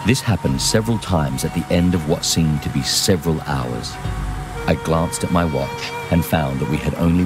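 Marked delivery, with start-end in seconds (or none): none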